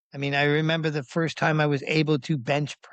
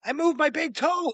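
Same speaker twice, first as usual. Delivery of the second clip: high, insistent cry; worked-up, triumphant exclamation; retold excited announcement; 14 semitones higher than usual. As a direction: proclaim it with the voice pitched way up. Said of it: "I'm going".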